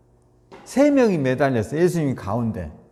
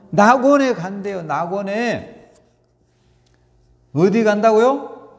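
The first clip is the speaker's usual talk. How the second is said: higher, louder, slow call; preaching shout; 7 semitones higher, 5 dB louder, slower than usual. Real, not acted. Preacher calling the promise out to the congregation, loud and slow. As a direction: ring it out to the back row.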